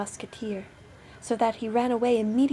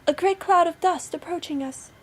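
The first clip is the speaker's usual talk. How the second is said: high, raised lookout voice; sister on lookout; 8 semitones higher, 6 dB louder than usual. A narrator's reading. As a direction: report down from the tower. Sister reports from the tower.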